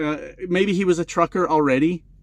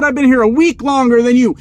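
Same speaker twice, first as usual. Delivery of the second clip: higher, louder bully boast; mock threat; 6 semitones higher, 7 dB louder than usual. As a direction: get in his face with it.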